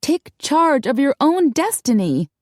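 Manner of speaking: rally them with firm conviction; high, deliberate exhortation; urging. A narrator's reading. Darcy urges the women.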